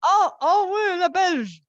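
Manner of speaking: high, loud "oh" of recognition; sudden realization. Real, not acted. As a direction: exclaim as you stand corrected. Corrected: Belgian, not French. (prise de conscience soudaine, exclamation aiguë)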